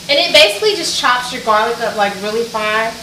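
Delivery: ordinary delivery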